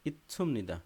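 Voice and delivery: ordinary talk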